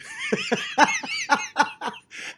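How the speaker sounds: high burst of laughter at his own joke; squeaky giggle; real laugh. Real, not acted. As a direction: crack up at the memory.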